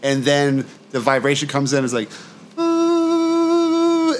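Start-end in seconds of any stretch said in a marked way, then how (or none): 3.0-4.2 very high eerie drawn-out "Boo"; spooky boo. Retold or imitated. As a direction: moan "Boo" like a ghost vibration. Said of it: "the other vibration's like".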